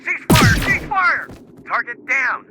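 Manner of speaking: shouting orders over gunfire; loud frantic combat yell; battle shout. Acted. Soldier shouting during firefight.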